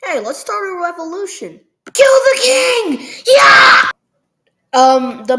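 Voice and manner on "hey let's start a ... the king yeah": rising from a higher call to a full, much louder shout; rebel shout. Acted. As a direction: whip up the crowd, then roar. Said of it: "the farmers were all like".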